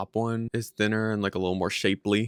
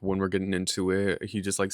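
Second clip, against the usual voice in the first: usual speaking voice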